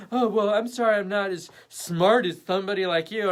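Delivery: hammed-up offended retort; personally offended. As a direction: play up a huffy, sarcastic apology. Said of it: "they're personally offended".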